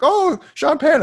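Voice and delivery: high, loud, drawn-out "oh" falling away; excited reaction. Retold or imitated. Film fan retelling his reaction to seeing Sean Penn, excited. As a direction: pitch it up in sudden delight. Acting out own first reaction.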